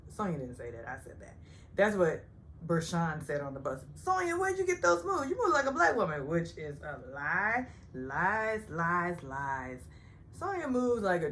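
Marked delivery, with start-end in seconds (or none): 3.9-6.3 mock gushing. Bershawn's bus remark mimicked.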